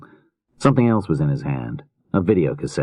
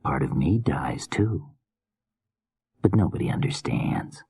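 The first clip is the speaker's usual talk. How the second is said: hushed and gentle; soft whisper; 5 dB quieter than usual. Acted. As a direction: whisper it softly. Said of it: "he said softly".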